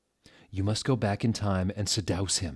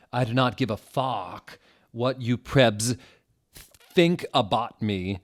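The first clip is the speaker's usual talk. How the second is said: a put-on defiant teen voice, slowing down at the end; hammed-up teen defiance; mock angry author; 4 semitones higher, 5 dB louder, slower than usual. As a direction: sneer it like a touchy teen writer. Reading the author's angry note.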